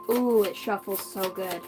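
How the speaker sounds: ordinary delivery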